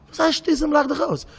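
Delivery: very high, incredulous echo; indignant echo. Acted. Hurt man repeating the brush-off, high indignant protest. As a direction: throw the words back in a high, offended voice.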